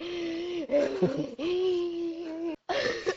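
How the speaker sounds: high, drawn-out mock wheeze; exhausted wheezing. Runner back at the start point, hammed-up gasping. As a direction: act out gasping for air. Acts out gasping at run's end.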